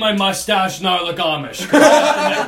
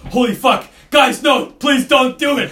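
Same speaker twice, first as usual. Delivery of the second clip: retold panicked plea, raised and loud; higher, louder mock begging; pleading; 5 semitones higher, 6 dB louder than usual. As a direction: beg them loudly to stop, acting it out. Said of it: "he's pleading for his life".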